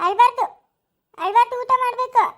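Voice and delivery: high, loud, scolding caretaker voice; stern nanny coaxing. Acted. Orphanage caretaker ordering a crying child to eat, high and loud.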